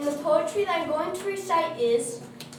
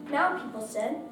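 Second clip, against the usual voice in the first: ordinary delivery, nothing marked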